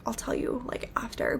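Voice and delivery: hushed whisper to a friend; secretive murmur; whispered aside. Retold or imitated. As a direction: whisper it so the driver can't hear. Whispering to Missy in the Uber.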